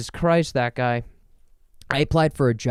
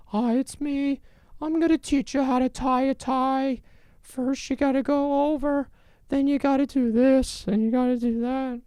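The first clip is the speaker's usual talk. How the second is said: tutorial voice; 12 semitones higher than usual. Retold or imitated. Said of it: "It's like".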